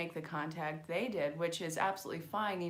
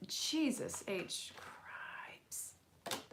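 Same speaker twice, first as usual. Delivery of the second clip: slow, stunned whisper; whispered oh-no at a jumping card; exasperated whisper; 6 semitones higher, 6 dB quieter, much slower than usual. Real, not acted. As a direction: breathe it out in disbelief. Reacting to a card spilling.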